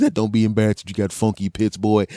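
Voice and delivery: loud, hearty father voice; gruff dad. Acted. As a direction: boom it like a proud dad. Dad talks to son.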